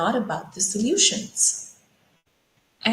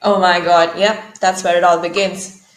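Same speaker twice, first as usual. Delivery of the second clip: loud exclaim; 7 dB louder than usual. Real, not acted. Mock outrage at exclamation points.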